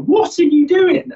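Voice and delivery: high drawn-out disbelief; body protesting. Retold or imitated. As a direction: voice the body's shocked complaint up high. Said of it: "my body was going".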